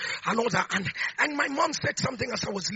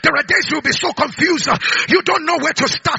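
a bit louder, urgent exhortation; fervent preaching; 2 semitones higher, 7 dB louder than usual. Acted. Preacher exhorts believers not to let prayer die: urgent preaching. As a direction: press the point home.

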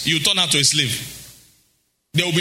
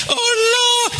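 swooning cry; 14 semitones higher than usual. Retold or imitated. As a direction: cry out like a smitten churchgoer. Imitating swooning listener.

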